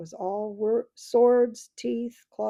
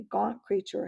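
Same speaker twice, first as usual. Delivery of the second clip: ordinary delivery, nothing marked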